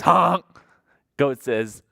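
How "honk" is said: a loud honk; goose honk. Acted. Storyteller honks as the goose. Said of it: "Goose says".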